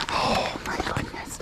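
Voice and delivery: hushed fond whisper. Real, not acted. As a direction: whisper in delight to the goat. Cooing to a goat.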